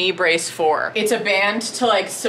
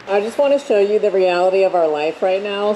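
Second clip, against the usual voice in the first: normal talking voice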